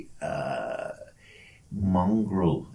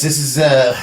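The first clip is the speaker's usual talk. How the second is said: ordinary talk, nothing marked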